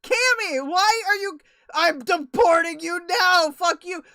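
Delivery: hammed-up fury at a chatter; mock outrage. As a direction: yell back at the threat in fake fury.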